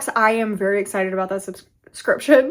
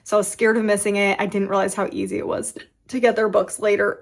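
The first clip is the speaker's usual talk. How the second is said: ordinary delivery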